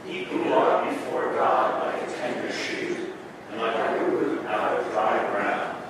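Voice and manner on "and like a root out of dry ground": ordinary talk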